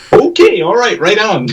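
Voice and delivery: very high, comic agreeable voice; chirpy imitation. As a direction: pipe up high as an oddball helper. Imitating Sam popping up agreeably.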